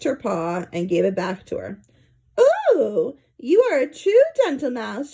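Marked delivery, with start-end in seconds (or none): 2.7-5.1 lightly raised, squeaky lady mouse; a hint of a squeaky, sweet stewardess; squeaky flight attendant. A narrator's reading. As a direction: coo it up high like a flirty attendant. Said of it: "she squeaked".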